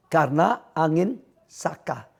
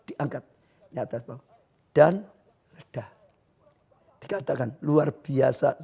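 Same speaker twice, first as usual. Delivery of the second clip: soft, low, solemn sermon voice; hushed preaching; 3 semitones lower, 6 dB quieter than usual. Real, not acted. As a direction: drop down low and reassure them. (khotbah pelan dan khidmat)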